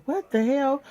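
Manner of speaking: high exclaimed what the hell. Real, not acted. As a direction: throw it out high in disbelief. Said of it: "let's say it together".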